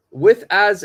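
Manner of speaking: with as, as punched high and loud; stress on as. Shown as a demonstration. Teacher to class, flagging the key word: with as, as punched high and loud. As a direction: hit as hard, high and loud to flag it.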